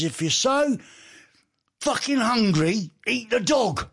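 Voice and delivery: high-pitched, badly attempted New York accent; rough imitation of an American friend. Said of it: "in his American accent, which I can't do".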